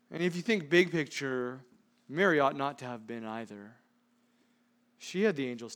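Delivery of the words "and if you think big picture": normal talking voice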